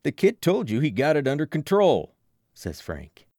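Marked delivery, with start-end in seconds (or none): 0.0-2.1 lightly voiced tough older guy, louder; firm, protective, a bit louder; Frank's voice. A narrator's reading. Frank defending the kid coach, firm and loud.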